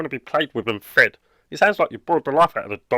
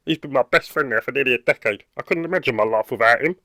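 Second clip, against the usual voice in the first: ordinary delivery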